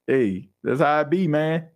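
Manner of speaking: normal talking voice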